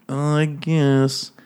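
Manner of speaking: slow, drawn-out mock concession; feigned reluctance. Heroine told to consummate the marriage, mock reluctant. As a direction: drag it out as if grudgingly agreeing.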